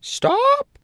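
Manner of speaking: very high, loud stop; very high, loud hen cry; hen's cry. A narrator's reading. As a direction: cry out to halt him. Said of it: "the hen suddenly cried".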